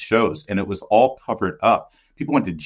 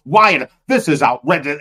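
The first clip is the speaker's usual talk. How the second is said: imitating indignant staffers, higher on the repeated question; mock disgusted outrage; 5 semitones higher, 4 dB louder than usual. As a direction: act out scandalized disbelief, louder bursts. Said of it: "They were disgusted".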